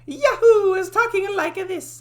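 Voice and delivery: very high, slow squeaky voice with a laugh in it; high-pitched character voice. Shown as a demonstration. Demoing the game mascot's voice, squeaky and high. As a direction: pitch way up and drag it out, giggling. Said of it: "sort of, but a little bit more high-pitched".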